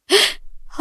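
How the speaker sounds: quick high unvoiced intake of breath; sharp fearful gasp. Galal caught out at dinner, gasping in fear. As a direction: catch your breath in fright.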